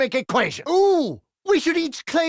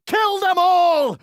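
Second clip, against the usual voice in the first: battle shout; 6 semitones higher, 5 dB louder, slower than usual. Acted. Urging ally in battle.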